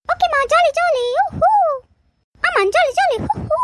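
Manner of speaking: put-on high-pitched child cheering with glee; excited children. Children cheering to mother over promised treats, high and gleeful. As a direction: squeal it out like thrilled little kids.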